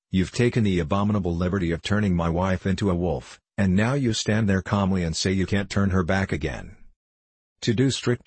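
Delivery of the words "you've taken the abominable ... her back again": ordinary delivery, nothing marked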